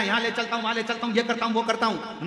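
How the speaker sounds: a hint of a hawking driver; auto driver touting. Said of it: "auto वाला कहेगा".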